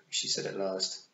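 ordinary talk

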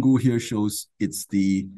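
very slow, deliberate explanation; walk them through it slowly. Shown as a demonstration. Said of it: "I have to go slowly".

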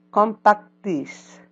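slowed model of Compact disc; slow model word. Shown as a demonstration. Teacher slows down to model the word. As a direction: draw out Compact disc for the class. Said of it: "repeat after me".

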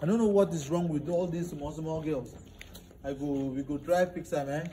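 smooth it over with the guest; soft apology. Acted. Brother apologizes softly to visitor.

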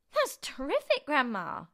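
put-on high, loud boy's cheer; excited cry. A narrator's reading. George cheers grandma on her feet, excited cry. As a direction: cry out in high-pitched delight. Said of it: "George cried".

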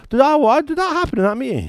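puzzled churchgoer. Retold or imitated. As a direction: play a forgetful listener, amazed. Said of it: "And other people go".

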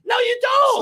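heated, high-pitched objection; emphatic rebuttal. Real, not acted. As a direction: shoot it down, pitch jumping up. Heated rebuttal in a real argument.

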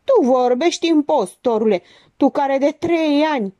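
high, indignant accusation; angry outburst. A narrator's reading. Stratian turns on his accuser, bursting out indignantly. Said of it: "Stratian izbucni".

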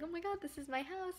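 high, soft mock squeal; hushed, high-pitched imitation of excitement; imitating delight. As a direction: quote thrilled buyers in a small high voice. Voicing delighted home buyers in a quote.

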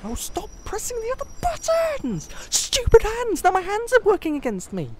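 high, strained yelling; real frustration. Real, not acted. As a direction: yell at your own useless hands. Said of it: "really frustrated now".

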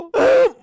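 high, sharp gasp of alarm; startled gasp. Acted. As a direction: gasp as the meteor appears. Sees the meteor coming.

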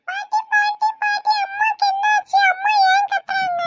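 put-on high, slow little child's voice, anxious; a worried little kid tugging at grandma; small child. Grandchild asking grandma why mother screams, high worried child voice.

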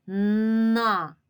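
на with a long, loud hummed н; stretched first consonant in на; drawn-out sound. Shown as a demonstration. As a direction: hold the н before releasing на. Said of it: "Починається н".

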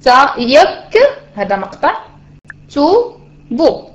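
very slow, tا يك and تو بو split into syllables. Shown as a demonstration. Teacher splitting the word into syllables for students, slowly. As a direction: space out each syllable slowly. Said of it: "الحرف الساكن مشى معايا".

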